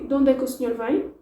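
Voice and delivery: quick, linked; De onde é que o senhor vem as one fast chunk; fast native speed. Shown as a demonstration. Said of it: "If, if I say".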